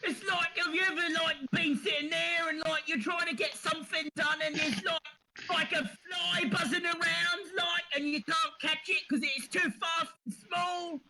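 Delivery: high, whiny Cockney rat in a flap; squeaky Cockney rat voice; panicky Cockney rat. Acted. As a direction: wail like a fretting street rat. Rat voice answers.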